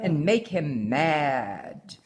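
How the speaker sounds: put-on reading a picture book aloud to her child; story reading. A narrator's reading. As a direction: tell the tale gently, like a bedtime story. Mother reads story aloud.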